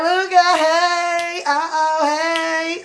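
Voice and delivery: high, loud sing-song party hollering; acted tipsy whooping. Imagining herself on wine tonight, playfully whooping in high sing-song. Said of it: "I'm really gonna be like".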